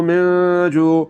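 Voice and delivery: very slow من جوع, noon held in the nose; ghunna on noon. Shown as a demonstration. Teacher shows students ghunna in من جوع, slow and nasal. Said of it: "آواز کو ناک میں لے جا کر تھوڑا سا لمبا کرنا ہے".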